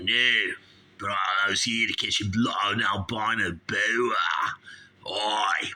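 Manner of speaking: broad mock Aussie; Australian accent. Snake handler explains himself to host, broad Australian accent. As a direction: stretch the vowels like a comic Australian. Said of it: "he's from Australia".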